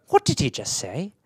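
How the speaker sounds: soft mother imitation; softly voiced maternal query; questioning mother. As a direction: mimic a mother's quiet, puzzled question. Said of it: "she would just go".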